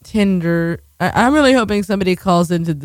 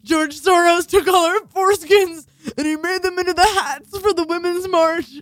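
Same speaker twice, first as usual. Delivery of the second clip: shrill, very high shouted rant; ranting conspiracy nut; 10 semitones higher than usual. Pretend. Host mocking Soros conspiracy theorists, shrill shouted rant. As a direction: shriek it like a crank.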